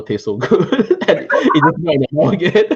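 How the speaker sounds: amused, laughter breaking into the words; laughing through words. Real, not acted. Laughing to friends about melt-in-the-mouth squid. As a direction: let a laugh spill over the line.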